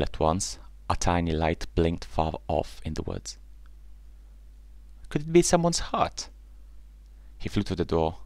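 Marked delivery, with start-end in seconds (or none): 5.1-6.3 high-pitched duckling question; tentative hope, pitched way up; hopeful wondering. A narrator's reading. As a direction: wonder aloud in a lifted, high voice. Duckling spots a light, hopeful.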